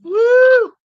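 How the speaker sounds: loud high "woo"; high-pitched cheering whoop. Real, not acted. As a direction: let out a piercing, excited whoop. Whooping while viewing old photos.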